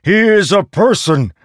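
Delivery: high loud shout. Acted. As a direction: shout it in protest. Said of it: "Rlain shouted".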